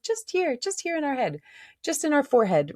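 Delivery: very high, tiny voice; mock narrow focus. Shown as a demonstration. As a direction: pitch it way up, as if squeezed into the head. Mimics being zoomed into the head.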